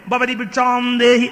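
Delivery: loud, drawn-out begging call; calling for alms. Acted. Bhima begs at the door behind a giant pot, calling out. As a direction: call it out slowly and loudly, stretching the words.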